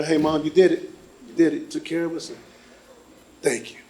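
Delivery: soft, tender goodbye; tender farewell. Retold or imitated. Son talking softly to his mother's casket, tender. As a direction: speak gently to mom in the casket.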